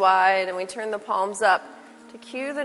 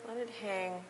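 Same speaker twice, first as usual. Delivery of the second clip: soft spa whisper; 5 semitones higher, 10 dB quieter than usual. Acted. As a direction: lull them into stillness softly. Guided relaxation in Shavasana.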